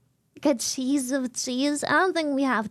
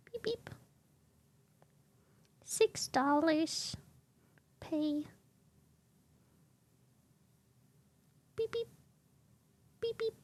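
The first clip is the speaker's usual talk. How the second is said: imitating the app while ordering; mock beeps; 2 semitones higher, 10 dB quieter, slower than usual. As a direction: chirp it like a machine.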